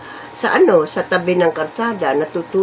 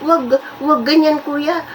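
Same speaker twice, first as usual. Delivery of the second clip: high-pitched imitation of a critical neighbour; imitated busybody, high objection; mock objecting neighbour; 6 semitones higher than usual. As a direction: mimic a neighbour scolding, pitched well up. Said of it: "sasabihin pa nung kapitbahay".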